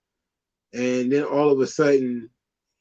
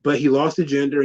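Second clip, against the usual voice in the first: usual speaking voice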